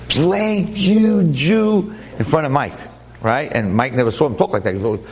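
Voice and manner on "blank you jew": high, mock-furious insult; retold anger, pitched well up; imitated angry outburst. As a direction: act out someone suddenly losing his temper. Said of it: "Got so angry, he said".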